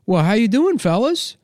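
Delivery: very high, chipper soda-jerk voice; hammed-up old-timer, high and folksy; old-timey soda jerk. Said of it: "old-timey, like, a soda jerk guy".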